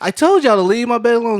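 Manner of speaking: mock-indignant grandmother confronting the grandkids; accusing granny. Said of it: "and she's like".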